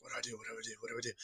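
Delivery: hushed, frantic repeating; panicked whisper. Retold or imitated. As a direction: whisper it to yourself, scared of being heard. Panicked hiding from sheriffs.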